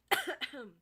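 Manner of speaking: sudden choking cough. Real, not acted. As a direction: cough on swallowed spit. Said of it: "I'm choking".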